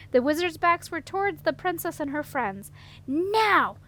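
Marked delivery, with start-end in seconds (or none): 3.1-3.8 loud high battle cry. A narrator's reading. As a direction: give the signal to attack. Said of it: "Cimarron cried".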